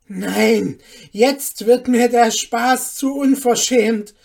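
very loud, outraged outburst; indignant shout. A narrator's reading. Mother calling to her neighbour, indignant shout. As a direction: shout that you've had enough. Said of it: "rief Hanne ihrer Nachbarin zu".

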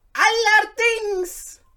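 very high, slow, drawn-out exclaim; delighted eunuch. A narrator's reading. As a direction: gush with joy. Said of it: "rief der erste Eunuch hocherfreut".

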